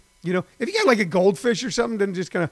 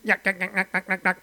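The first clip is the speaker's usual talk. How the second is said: softer mock Stooges nyuk nyuk; Curly yuck yuck; 4 dB quieter than usual. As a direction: rattle off a goofy slapstick chuckle. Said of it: "That's Curly".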